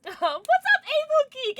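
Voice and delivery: high, slow squeaky hello; sing-song streamer greeting; cutesy squeal greeting. Real, not acted. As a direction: squeal a welcome to the viewer.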